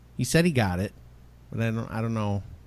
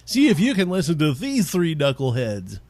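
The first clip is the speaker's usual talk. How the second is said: lightly mocking, higher and louder intro voice; imitating co-host's opening; 4 semitones higher, 5 dB louder than usual. Host quoting co-host's opening back at him, lightly mocking announcer. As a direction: throw it out like a hammed-up show opening. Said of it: "You were like".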